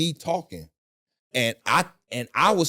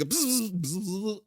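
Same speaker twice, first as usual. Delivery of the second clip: higher buzzing machine sound; mock computer buzz while analyzing; computer noise; 7 semitones higher, 4 dB quieter, much slower than usual. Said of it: "like a fucking computer".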